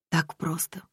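soft evasive whisper. Acted. Daughter dodges her father's question, whispering. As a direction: breathe it out, dodging.

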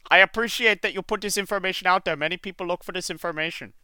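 put-on fast, high Indian accent. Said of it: "your Indian accent".

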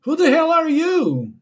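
shocked high-pitched yell; startled shout. Acted. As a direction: blurt it out in a shocked high squeal. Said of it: "sorry for that".

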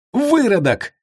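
very high, angry cry; furious insult. A narrator's reading. Bound commander jerks at his ropes, furious. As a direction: hurl it at the top of the range.

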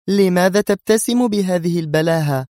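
high, irritated question; sharp rebuke. A narrator's reading. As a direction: demand it sharply. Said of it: "تقول في حدة بالإسبانية". (بحدة)

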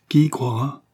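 indignant. A narrator's reading. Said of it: "continua Skvortsov, indigné".

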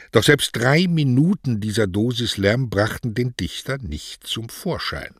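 ordinary delivery

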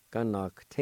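"Connacht" run together as one word; connected take of "Connacht"; joined-up pronunciation. Shown as a demonstration. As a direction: blend "Connacht" without gaps. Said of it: "like this".

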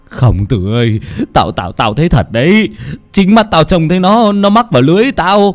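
high, loud, stammering wail of fear; terrified insistence. Acted. Frightened fisherman insists to Tư he saw it, terrified wailing. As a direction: insist desperately, pitch climbing into a wail.

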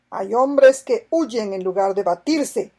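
loud, slow angry outburst; with anger. A narrator's reading. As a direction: spit out the contempt slowly. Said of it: "exclamó Sandokan con ira". (con ira, fuerte y lento)

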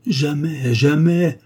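vehement refusal. A narrator's reading. Duke refusing to part from his mistress, vehement and impassioned.

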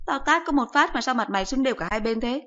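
lightly voiced sarcastic mother; incredulous mother. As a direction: scoff at the son like a tart mom.

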